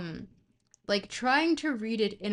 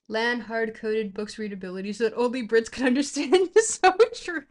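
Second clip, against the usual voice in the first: laughing; 3 semitones higher, 9 dB louder than usual. Real, not acted. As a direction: crack up while reading it out. Cracks up reading a chat comment.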